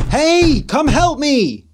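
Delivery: high, loud desperate yell. Acted. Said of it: "So he banged harder".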